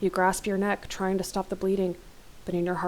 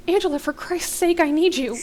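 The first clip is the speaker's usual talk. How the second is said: high, loud plea for help; yell in pain; 10 semitones higher, 6 dB louder than usual. A narrator's reading. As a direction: yell desperately to your wife. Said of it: "You yell in pain and ask for help".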